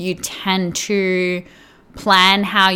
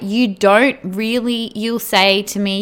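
ordinary delivery

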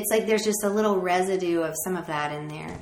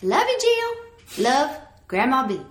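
put-on grandmother's Southern accent; 6 semitones higher, 5 dB louder than usual. Singer mimicking grandmother's message sign-off, thick Southern accent. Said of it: "would not even be able to really discern what my grandmother's even saying".

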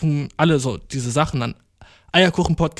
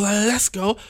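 hyped; 6 semitones higher than usual. Real, not acted. Hyped catchphrase.

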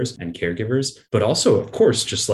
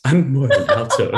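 laughing; 5 semitones higher, 6 dB louder than usual. Real, not acted.

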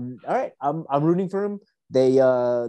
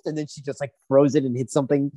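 ordinary talk, nothing marked

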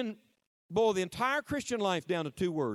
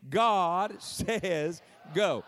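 very slow, fervent Pentecostal proclamation; drawn-out Pentecostal pulpit cry; Pentecostal preacher; much slower than usual. Shown as a demonstration. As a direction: stretch every word like a Pentecostal preacher. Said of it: "say it like a Pentecostal preacher".

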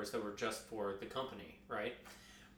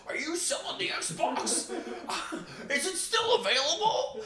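much higher, laughing eager caller voice; eager buyer; 4 dB louder than usual. Retold or imitated. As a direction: play the keen buyer asking about the Xbox, laughing. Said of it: "somebody would be like".

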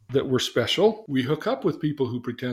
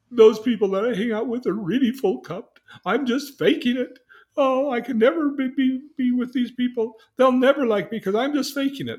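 distressed; 11 semitones higher, 6 dB louder than usual. Retold or imitated. Girl upset, feels a fake.

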